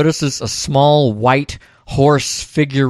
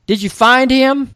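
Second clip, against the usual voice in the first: high-pitched Texas twang; 12 semitones higher, 4 dB louder than usual. Retold or imitated. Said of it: "She asks in a Texas twang".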